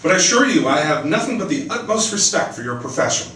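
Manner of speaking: story narration. A narrator's reading. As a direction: read the scene aloud like an author at a reading. Author reads her novel aloud.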